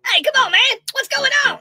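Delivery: high, loud confused cries; bewildered. Acted. Biden baffled at being dumped, high bewildered cries. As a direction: sound lost and alarmed.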